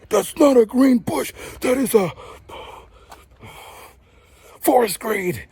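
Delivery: hammed-up angry nitpicker; mock indignant correction. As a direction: act out a petty know-it-all insisting. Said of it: "they're so angry about it".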